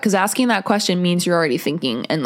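ordinary talk

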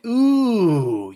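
high, sweeping ooh; final ooh. Pretend. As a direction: finish with one big high ooh. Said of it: "I'm gonna end it with this one".

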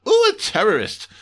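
loud high gleeful cry; thrilled by it; delighted alien boss. Acted.

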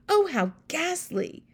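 high, slow exclamation; horrified cry. A narrator's reading. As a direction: draw it out in horror as the queen. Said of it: "the famous voice cried out".

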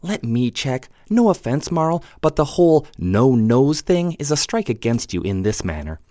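put-on quick, bright-eyed girl; young woman character voice. Jade's lines in the story.

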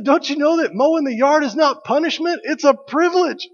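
lightly hammed-up scandalized dad; Hank Hill lecturing. Dad scolding the neighbor kid, mock scandalized. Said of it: "he said".

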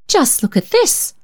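aunt exclaiming over the stained dress. A narrator's reading. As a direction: thrust the dress forward with an outraged cry. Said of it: "exclaimed Aunt Charlotte".

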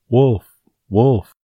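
high, loud call for help; shouting. Acted. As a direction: shout it to the village. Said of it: "the shepherd boy again shouted".

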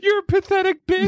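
high-pitched mimicry of a girl's insult; put-on high voice, quoting the film; mocking movie quote. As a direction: squeal the insult in a mock little-kid voice.

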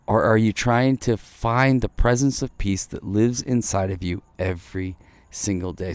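ordinary delivery

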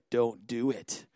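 low, slow voice in the head; inner warning voice. Retold or imitated. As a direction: play a conscience warning in a deep tone. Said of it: "you have, like, this voice".

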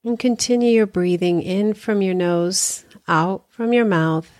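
unhurried meditation guide with long pauses; guided meditation. Acted. Hypnotherapist guiding listeners through body relaxation, calm guided meditation. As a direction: ease them through breathing and relaxing, leaving space after each phrase.

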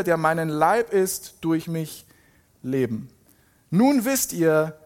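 emphatic, deliberate proclamation with stressed key words; dramatic scripture reading. Acted. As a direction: drive each statement home, pausing before the close. Reads Jesus' speech emphatically. (eindringliche, betonte Bibellesung)

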